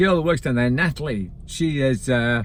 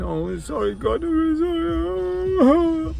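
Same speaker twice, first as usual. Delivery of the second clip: mock wailing sorry, much higher and drawn out; imitated crying apology; 14 semitones higher, much slower than usual. Retelling how Natalie broke down at the till, mock wail. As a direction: ham up a tearful apology, stretching sorry. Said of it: "Started crying".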